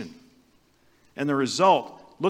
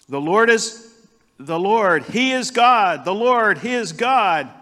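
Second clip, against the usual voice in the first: crowd proclaiming; 4 semitones higher, 8 dB louder, slower than usual. Retold or imitated. People crying out after fire falls.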